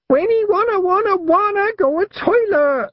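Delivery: put-on very high whiny child's scream; screaming toddler. A narrator's reading. Little brother Wentworth to his sister, high whiny scream. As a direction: wail it like a small boy desperate for the toilet. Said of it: "Wentworth shrieked".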